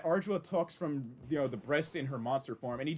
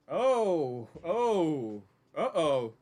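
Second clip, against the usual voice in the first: alarmed uh-oh; 4 dB louder, much slower than usual. Real, not acted. Something alarming shows up on screen.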